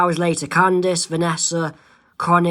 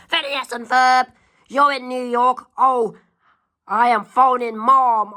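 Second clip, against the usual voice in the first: loud tattling; 7 semitones higher, 4 dB louder than usual. Acted. Candace scolds brothers, threatens tattling.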